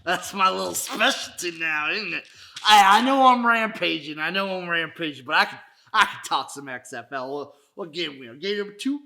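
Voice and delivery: lightly voiced rowdy intruder, laughing; rampaging visitor. A rowdy visitor barging in on Jim, lightly voiced. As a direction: barge in as a wild guest hiding out.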